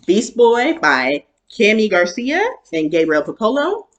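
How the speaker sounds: ordinary delivery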